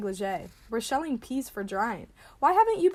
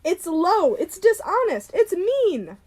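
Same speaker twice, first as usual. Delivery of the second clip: high, loud accusations; furious denunciation; 6 semitones higher, 6 dB louder, slower than usual. Acted. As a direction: hurl each charge in outrage. Denounces neighbor in quarrel.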